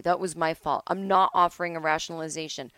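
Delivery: ordinary talk, nothing marked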